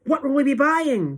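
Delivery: high, loud eager question; crowd cry. A narrator's reading. Witches questioning their leader, high excited cry. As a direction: cry out, desperate to know. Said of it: "they cried".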